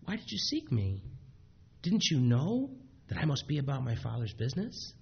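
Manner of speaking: put-on soft, sweet twelve-year-old voice; lightly voiced gentle boy; gentle meek boy. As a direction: ask it meekly like a young boy. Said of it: "gentle, sweet, meek voice".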